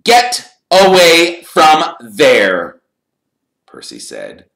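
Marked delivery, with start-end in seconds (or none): 0.0-2.8 loud, slow, high command; scolding order. Acted. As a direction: order them off, weighting every word. Percy angrily orders them away.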